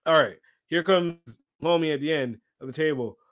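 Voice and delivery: ordinary talk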